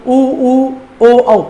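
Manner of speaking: उ उ ओ औ sounded out one by one with lips rounded; clear, separated vowel demo; teacher shows the class the vowels उ and ओ; vowel sounds demonstrated. Shown as a demonstration. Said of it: "मैं कुछ स्वर बोल रहा हूं".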